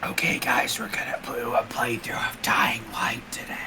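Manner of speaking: whispering gaming streamer; mock ASMR whisper introducing a playthrough; whispered asmr role play. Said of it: "Oh, I have so much ammunition".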